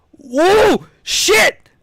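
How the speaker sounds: sudden loud, high yelp of alarm; real fright while playing, loud and high; startled shout. Real, not acted. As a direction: jump out of your skin and yell. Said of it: "Give me a second to recover".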